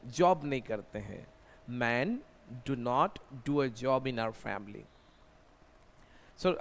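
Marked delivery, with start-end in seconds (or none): 1.8-4.8 slow dictation. Shown as a demonstration.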